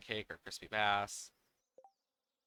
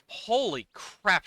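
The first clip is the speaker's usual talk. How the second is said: louder surprised exclamation; amazed outburst; 8 semitones higher, 7 dB louder, slower than usual. Real, not acted. Surprised at list.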